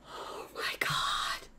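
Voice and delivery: slow, voiceless whisper of awe; stunned whisper. Real, not acted. Streamer overwhelmed by the summons, stunned whisper. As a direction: breathe it out slowly, barely audible.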